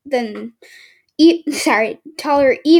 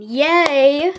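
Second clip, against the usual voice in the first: sarcastic; 3 semitones higher than usual. Real, not acted. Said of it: "that was sarcastic".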